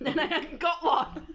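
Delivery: cracking up mid-sentence; laughing. Real, not acted. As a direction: laugh through the punchline. Laughing at own joke.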